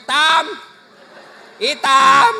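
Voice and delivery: loud laughing call of "Hitam". Retold or imitated. Husband bluntly calling his dark-skinned wife, loud and laughing. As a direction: call her loudly, half laughing.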